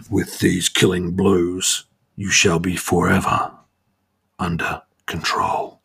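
slow, deliberate villain threat, sinking at the end; menacing villain. Acted. Controller dealing his finishing blow, slow villain menace.

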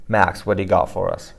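ordinary talk, nothing marked

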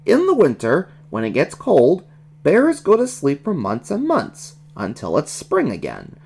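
put-on lilting child character explanation; explaining baby Scooter. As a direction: explain it like a keen little know-it-all.

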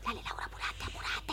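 hurried whispered apurate; urgent whisper. Acted. Friend to Laura, hushing so dad won't hear: urgent whisper. Hurrying friend secretly at night.

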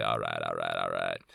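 low, lazy, contented all right. Pretend. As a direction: savor it like a man at ease by the beach. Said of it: "I just go outside and say".

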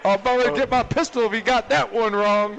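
mock Southern coach, retold; Southern accent. Host repeats the old Auburn coach's line in a Southern accent. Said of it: "I'll try to do the accent".

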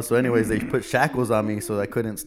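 ordinary talk, nothing marked

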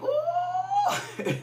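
hushed ecstatic cry; soft high ooh. Real, not acted. Preacher overcome mid-sermon.

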